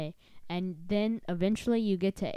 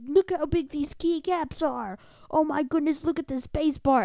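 much higher, louder gushing voice; excited YouTuber; 8 semitones higher, 7 dB louder than usual. Retold or imitated. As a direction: gush over the keycaps like a superfan. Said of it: "they're like".

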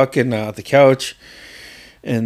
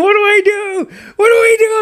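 very high, louder frantic repeated shriek; panicked scream; 4 dB louder than usual. Retold or imitated. Cousin Dave screaming for help as the ground shakes, high frantic shriek. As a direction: mimic a grown man squealing in panic. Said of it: "he's screaming".

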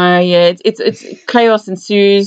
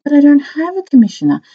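high, gentle boss voice; nice captain; 6 semitones higher than usual. Retold or imitated. Retelling a film scene, a lightly voiced sweet captain. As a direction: play the kindest boss ever. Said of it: "his captain is so nice".

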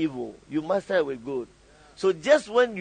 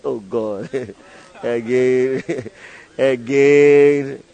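long drawn-out weary groan, swelling louder; mock exasperation; 2 semitones lower, 5 dB louder, much slower than usual. Tired helper dreading yet another call, mock exasperated. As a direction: groan each word out slowly, half laughing.

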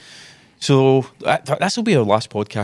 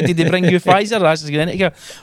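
mock Christmas boast; 6 semitones higher than usual. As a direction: ham up a child comparing Christmas presents. Mocking people comparing vaccine brands.

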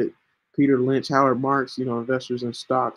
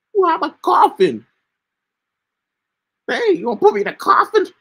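much higher and louder, squeaky to dodge a cough; high squeaky voice keeping the cough at bay; high-pitched voice; 6 dB louder than usual. Real, not acted. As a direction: lift way up into a thin high voice so you don't cough. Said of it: "talk in a high-pitched voice".

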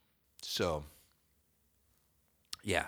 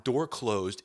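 usual speaking voice

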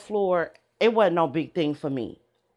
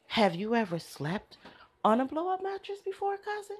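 soft, higher, sweetly asking; a gentle teasing question, much softer; gentle cousin voice; 8 semitones higher, 7 dB quieter than usual. Retold or imitated. Imitating the cousin asking about the mattress, soft and higher. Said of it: "She's very gentle".

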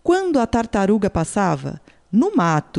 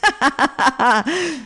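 big mocking laugh, a bit higher; jaguar's burst of laughter; 6 semitones higher than usual. Jaguar laughing at the turtle's boast, mocking laugh. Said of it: "A onça caiu na risada".

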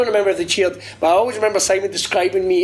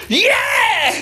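crowd cheer shout; 13 semitones higher than usual. Retold or imitated. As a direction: yell it like a roused crowd. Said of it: "they were like".

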